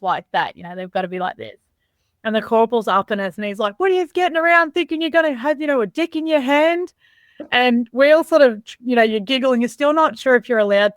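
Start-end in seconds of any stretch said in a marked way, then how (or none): 3.8-6.9 lightly voiced corporal telling off recruits, higher pitch; mock telling-off from a drill corporal; imitowany kapral. Corporal berating recruits, retold.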